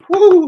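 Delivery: very high, loud whoop; excited woo; cheer. Real, not acted. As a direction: whoop like you just scored. Sudden cheer at the chat.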